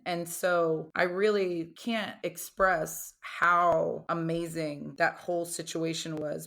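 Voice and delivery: normal talking voice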